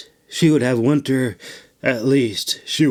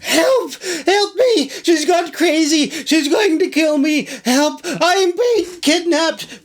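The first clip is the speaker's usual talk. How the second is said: very high, crying for help; terrified high wail; panicked wailing. Acted. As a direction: hysterical shrill calls for help. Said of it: "Traja wailed".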